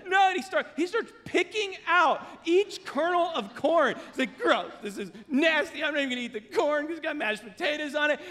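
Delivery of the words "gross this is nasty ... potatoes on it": high-pitched, rushed mock complaint; hammed-up freak-out, speeding up; imitated disgusted kid. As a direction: protest like a grossed-out son. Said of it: "he started freaking out".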